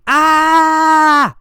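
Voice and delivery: loud, high, sustained "Ah!" scream. Acted. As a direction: let out one long high-pitched scream. Imitates the screaming man example.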